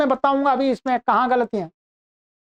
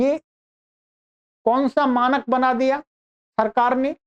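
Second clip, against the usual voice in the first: normal talking voice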